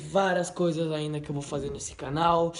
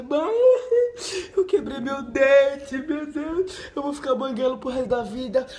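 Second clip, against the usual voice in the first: high, sobbing wail to mom, acted out from memory; retold crying plea; 8 semitones higher, 6 dB louder than usual. Boy with a broken tooth wails to his mother, sobbing high. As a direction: replay the panicked kid crying over a broken tooth. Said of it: "chorando, gritando".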